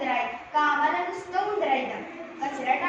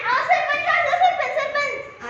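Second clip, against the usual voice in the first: high, loud pleading cries; frantic calling; 10 semitones higher, 8 dB louder than usual. Acted. Woman crying out to the sarpanch mid-fight, frantic high shout. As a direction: beg the headman to step in, loud. Panicked appeal during fight.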